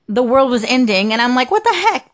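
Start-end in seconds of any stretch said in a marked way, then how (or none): 1.5-2.1 high-pitched retold disbelief; mock bewildered outburst; baffled exclamation. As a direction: blurt it out in puzzled surprise, pitch way up. Baffled reaction retold at scared dog.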